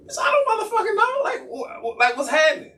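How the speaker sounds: high-pitched exasperated protest; stammering, indignant squeal; annoyed. Retold or imitated. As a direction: sputter in high, annoyed confusion. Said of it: "So I'm clearly getting annoyed".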